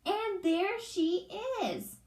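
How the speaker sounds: high, slow tiny cub voice; drawn-out little baby bear; wee little voice. Acted. Baby Bear pointing out the sleeping girl, high and slow. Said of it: "Baby Bear said in his wee little voice".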